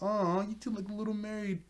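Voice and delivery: high, cooing imitation; teasing coo. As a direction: tease a cuddling pair with a drawn "aw". Retold teasing coo at a hug.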